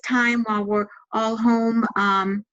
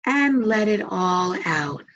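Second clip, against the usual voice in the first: calm, measured meditation voice with long pauses; guided meditation. Acted. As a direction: guide them gently into relaxation, leaving space to breathe.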